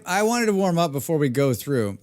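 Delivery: usual speaking voice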